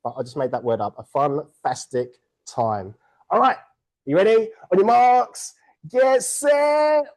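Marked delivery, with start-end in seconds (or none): 3.3-7.0 high, loud starter's call to the room; rally the racers like a starter. Real, not acted. Host starting the race for the room, loud high call.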